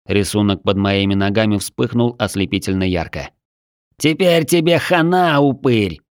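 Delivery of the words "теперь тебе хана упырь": yapping taunt. Acted. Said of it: "вякнул напоследок Смэш".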